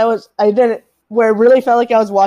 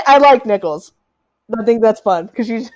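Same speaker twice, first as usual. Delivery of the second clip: ordinary talk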